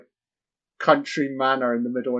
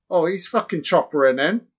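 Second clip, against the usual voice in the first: ordinary talk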